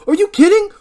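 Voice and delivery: very high, very loud rant; incredulous yelling. Acted. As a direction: shout back in frantic disbelief. Barry drunk and furious, arguing.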